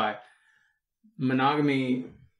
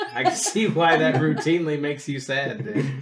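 laughing; 3 semitones higher, 6 dB louder than usual. Real, not acted. Laughing at friend's sad job.

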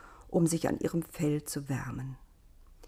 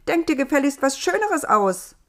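higher, loud demanding voice; bossy Eva; 8 semitones higher, 6 dB louder than usual. A narrator's reading. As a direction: demand something nicer.

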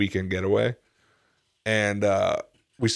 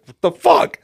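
loud, raised retold outburst; angry; 7 semitones higher, 7 dB louder, slower than usual. Customer retelling an hour's wait at the drive-in, angry. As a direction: blurt it in exasperated disbelief. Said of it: "I was fucking angry".

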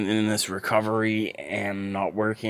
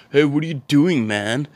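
loud, higher "Hey" from a brash stranger; loud greeting; 7 semitones higher, 8 dB louder than usual. Retold or imitated. Stranger at the car window, loud and brash.

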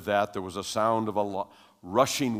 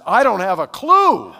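much higher, louder, slower disbelief; astonished friend; 11 semitones higher, 18 dB louder than usual. Retold or imitated. Kirk Junior answers his friend, baffled loud cry. Friend stunned after rushing wind.

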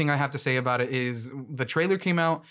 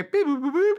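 high, loud chirpy robot beeping; robot beeps; 13 semitones higher, 4 dB louder than usual. Retold or imitated. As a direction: beep it out like a cartoon robot. Imitating robot noises.

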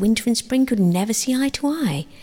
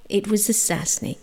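ordinary delivery

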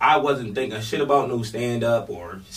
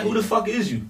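disrespectful; 6 semitones higher than usual. Retold or imitated. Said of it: "on some disrespectful shit".

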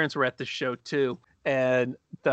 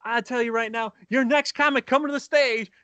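mock MC building from higher pitch to a drawn-out shout; stage MC introduction; 10 semitones higher than usual. Host bringing the comic to the stage, hammed-up announcer build. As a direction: build the hype, stretch the final name high. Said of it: "So he gets up on stage".

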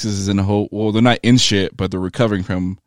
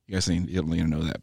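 normal talking voice

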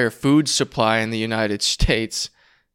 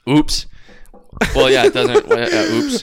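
mock oops; 8 dB louder, slower than usual. Teasing friend about overdue bill.

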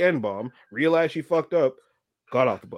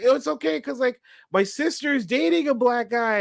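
imitating the girl; 9 semitones higher than usual. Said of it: "She's like".

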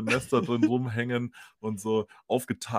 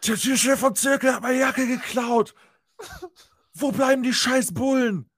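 much higher, louder imitated ranting; mock drunken yell; 13 semitones higher, 6 dB louder than usual. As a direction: screech the complaint like a raging drunk. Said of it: "schreit ins Telefon".

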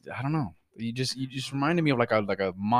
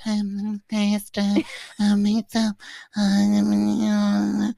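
mock bored cohost; 7 semitones higher, much slower than usual. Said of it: "You were like".

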